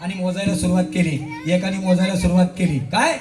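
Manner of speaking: usual speaking voice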